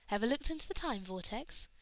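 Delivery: plain reading voice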